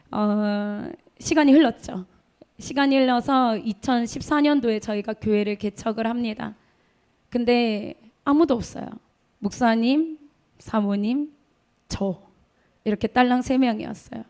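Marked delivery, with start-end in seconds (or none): none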